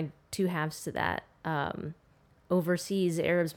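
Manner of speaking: usual speaking voice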